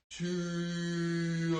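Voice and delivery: long drawn-out karate cry; slow-motion kiai. Shown as a demonstration. Coach punching in slow motion with kids, slow-motion kiai. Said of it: "I like that slow motion key out".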